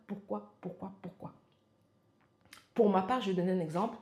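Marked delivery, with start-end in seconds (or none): 0.1-1.3 trailing off into a near whisper; whispered repeat. Real, not acted. Coach asking viewers their why, dropping to a whisper. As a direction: let the question fade to breath.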